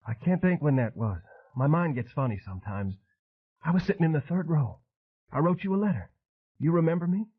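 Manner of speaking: high, eager and a little tremulous; hoping he remembers her, lift it up; trembling excitement. A narrator's reading. Rona asking Elvis if he remembers her, high and eager. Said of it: "Her voice trembled with excitement".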